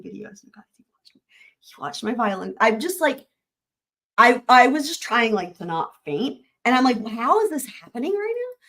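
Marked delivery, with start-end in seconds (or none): 0.0-1.7 breathless whispered aside; hushed disbelief; stunned whisper. Real, not acted. 7.0-8.6 high, incredulous question; wondering aloud in shock; stunned disbelief. Retold or imitated. Her stunned reaction on the call.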